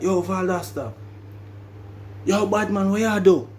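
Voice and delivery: high, riled Jamaican holler; mad Jamaican accent. Retold or imitated. [name] confronting Valdosta from down the dorm, mad Jamaican accent. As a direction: imitate an angry Jamaican calling someone out. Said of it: "when I get mad, my accent will come out".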